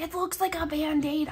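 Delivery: high, loud delighted exclamation; excited squeal. Real, not acted. Collector delighted by Band-Aid lookalike, excited squeal.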